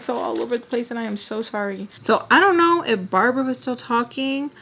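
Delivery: ordinary talk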